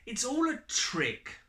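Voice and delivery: put-on high and loud child's exclamation; child scoffing at the story; defiant child. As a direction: declare it like a kid who has figured it out.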